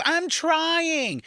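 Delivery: high, whining mock of her; whiny high voice. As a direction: whine it up high. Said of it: "When she says".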